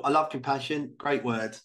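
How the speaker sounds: usual speaking voice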